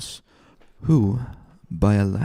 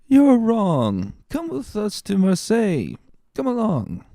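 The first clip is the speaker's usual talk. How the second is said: put-on high, wheedling drunk voice; tipsy coaxing; 13 semitones higher than usual. Drunk Caderousse coaxing Fernand to come along, slurred. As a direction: coax him along, slurring.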